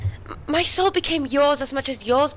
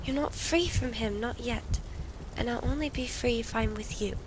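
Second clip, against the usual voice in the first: soft reassurance; 8 dB quieter than usual. Acted. Comforting a despairing partner.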